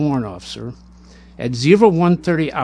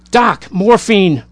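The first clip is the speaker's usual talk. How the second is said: desperate plea; 4 semitones higher, 7 dB louder, slower than usual. Acted. Wounded man begs for morphine.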